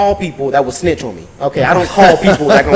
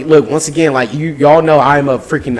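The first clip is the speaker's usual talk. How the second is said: usual speaking voice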